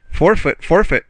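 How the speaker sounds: sudden high, loud playful call; cried out. A narrator's reading. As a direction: militia officer cries out to stop the French, loud and high. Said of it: "cried the militia officer".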